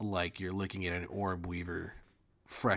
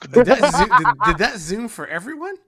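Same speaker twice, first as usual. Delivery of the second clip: loud, high, laughing question; laughing amazement; 15 dB louder than usual. Real, not acted. Game master asking players about the map zoom, laughing loudly. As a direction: ask it loudly through laughter.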